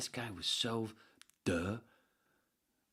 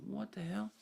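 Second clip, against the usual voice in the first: put-on much higher, baffled kid voice; imitating kids; 13 semitones higher than usual. Kids reacting to the teacher's pencil sharpening, baffled kid voice. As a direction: squeak it like a confused child. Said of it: "the kids are going".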